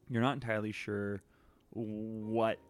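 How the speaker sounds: ordinary talk, nothing marked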